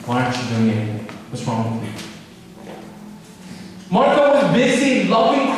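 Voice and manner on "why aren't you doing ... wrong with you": lower, exasperated scolding, a touch of real irritation; mock annoyed older sister. Martha scolding Mary for not helping, lower and exasperated. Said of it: "Martha got mad".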